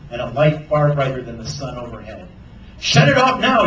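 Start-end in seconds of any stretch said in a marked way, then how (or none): 2.8-3.6 loud, high urgent yell. A narrator's reading. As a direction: yell to make it stop at once. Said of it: "yelled Sarah".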